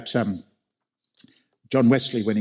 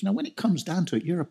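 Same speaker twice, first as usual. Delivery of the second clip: higher, rising preacher voice for the Lord praising the church; the Lord speaking; 8 semitones higher than usual. Retold or imitated. The Lord writing to the church at Ephesus, praising it ever higher. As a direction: build it up like a letter of praise. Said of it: "and he says".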